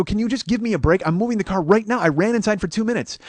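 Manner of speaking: high-pitched, urgent begging; acted-out agitated appeal; retold exasperated plea. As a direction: plead your case hard. Retold pleading for a break.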